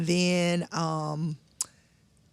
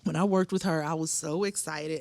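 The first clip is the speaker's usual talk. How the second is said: usual speaking voice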